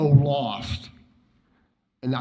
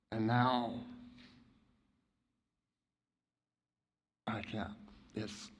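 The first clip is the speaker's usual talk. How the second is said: near-silent, choked with grief; voice breaking; 3 semitones lower, 8 dB quieter than usual. Real, not acted. Friend breaking down at Sean's memorial, choked and fading. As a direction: let the voice fail on the words.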